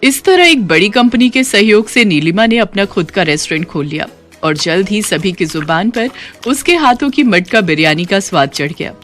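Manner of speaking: ordinary delivery, nothing marked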